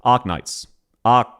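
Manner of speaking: "Arknights" and "Arc" with the R barely there; Aussie Arknights. Shown as a demonstration. Streamer teaching the game name, "Arc" with a dropped R. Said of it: "Listen to how I pronounce it".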